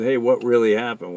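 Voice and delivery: ordinary talk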